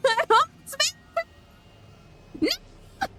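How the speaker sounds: high, slow, laughing wail; half-laughing high cry; laughing despair. Real, not acted. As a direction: wail it while laughing at the loss. Character dies at the gate.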